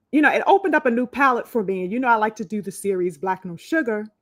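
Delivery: ordinary delivery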